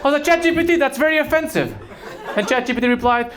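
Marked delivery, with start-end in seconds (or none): 0.2-1.7 shocked outrage. Retold or imitated. Shocked reaction to offensive joke.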